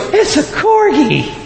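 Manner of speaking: high, slow gushing coo; gushing dog lover. Retold or imitated. Passersby fawning over corgi, slow high coo. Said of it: "Everyone goes".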